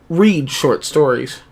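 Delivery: ordinary talk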